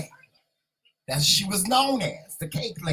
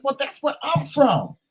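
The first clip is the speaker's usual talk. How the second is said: excited; 9 semitones higher than usual. Retold or imitated. Excited reply to newfound cousin.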